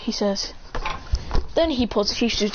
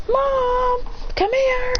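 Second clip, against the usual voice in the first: high-pitched call, no real volume; retold yell for mom; much slower than usual. Sarada summoning her mom, high mock yell. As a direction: call out high for your mother. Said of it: "Sarada yells".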